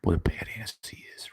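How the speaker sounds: whispered aside; mocking whispered badass. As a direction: whisper it like a secret boast. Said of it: "a pastor who wants to tell you".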